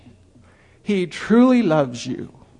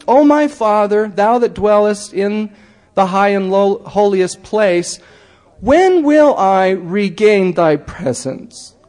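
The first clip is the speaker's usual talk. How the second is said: louder, slow pleading prayer; fervent prayer; 4 semitones higher, 4 dB louder, slower than usual. Acted. A soul praying to Heavenly Father, slow and fervent.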